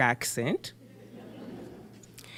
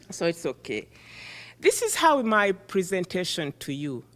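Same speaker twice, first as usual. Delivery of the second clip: ordinary talk, nothing marked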